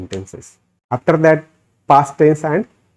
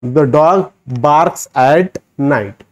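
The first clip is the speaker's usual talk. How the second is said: slow model answer; much slower than usual. Shown as a demonstration. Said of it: "option number one is the correct".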